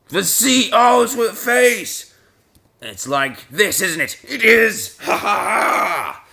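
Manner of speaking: loud, high burst of realisation; excited eureka, stretched out; triumphant discovery. Real, not acted. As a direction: crow over it and end in a drawn-out laugh. Suddenly finds the spelling.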